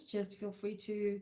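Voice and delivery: ordinary talk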